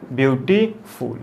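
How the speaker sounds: Beautiful slowly in three parts, ब्यू-टी-फुल; syllable by syllable. Shown as a demonstration. Teacher giving a long-word example, beautiful slowly syllable by syllable. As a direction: draw out and separate each syllable of beautiful. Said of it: "दो या दो से अधिक syllable".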